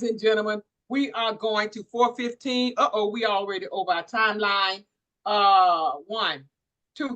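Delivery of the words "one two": usual speaking voice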